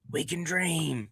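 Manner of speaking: dreamy hushed echo of a wish. Pretend. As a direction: breathe "We can dream" softly to yourself. Repeats the phrase, then hushes it.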